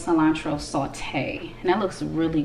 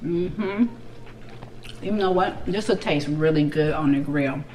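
muffled words between bites; talking while chewing. Real, not acted. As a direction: mouth partly full of food. Seen 2: Bites burger at -7; chews with closed lips, then talks with food.

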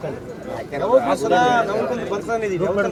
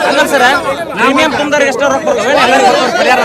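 loud, higher voice over the crowd; raised-voice arguing; 4 semitones higher, 12 dB louder than usual. Real, not acted. Arguing over crop insurance payouts in a noisy crowd, loudly. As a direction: argue it out at full volume.

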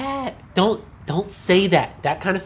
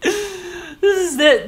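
laughing; 10 semitones higher, much slower than usual. Real, not acted. Laughing at the clip.

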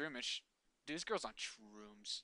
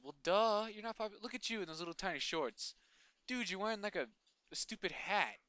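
loud, high mocking jeers; mocking taunt; 6 semitones higher, 4 dB louder than usual. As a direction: talk down to him like a bully. Taunting the trainer's outfit.

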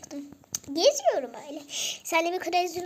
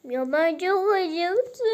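slow, loud mock-sobbing hungry pet; fake crying; 3 semitones higher, 7 dB louder, much slower than usual. Pet Cesur fakes tears to get fed, slow mock sob. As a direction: whimper it like a pretend-crying pet. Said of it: "Ağlama numarası yapıyorum".

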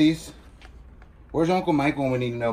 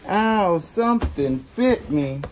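high, drawn-out movie-character yelp; Forrest Gump; 9 semitones higher, 4 dB louder, much slower than usual. Acted. Quoting a movie line while rummaging through boxes, in an impression. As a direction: stretch it out like the bitten film hero. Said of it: "I'm in Forrest Gump".

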